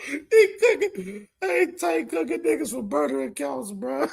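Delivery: high squeaky words through tears of laughter; crying laughing. Real, not acted. A guy cracking up over burner-account tweets, laughing high. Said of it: "I'm fucking crying".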